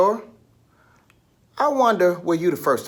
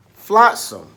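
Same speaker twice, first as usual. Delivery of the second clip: flotsam said louder and higher, a firm final hit; emphatic word; 6 semitones higher, 7 dB louder than usual. Shown as a demonstration. Teacher landing flotsam firmly after spelling it out. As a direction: punch out flotsam like a wrap-up. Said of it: "You don't know how to spell it?".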